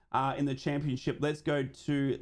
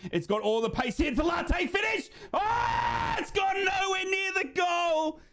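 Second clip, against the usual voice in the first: excited shout. Real, not acted. Big chance on the counter.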